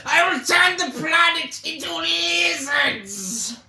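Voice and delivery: shouted, high cartoon villain boast; mustache twirling villain. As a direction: ham it up like an evil mastermind. Mocking the villain's plan.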